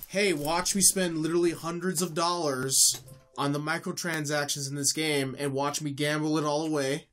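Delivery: ordinary delivery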